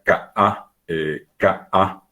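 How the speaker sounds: K A as ka, glottal break, then a; voice cut between vowels. Shown as a demonstration. Teacher showing the puso, K A split by a stop. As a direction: cut the voice inside K A. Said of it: "Separa, corta la voz".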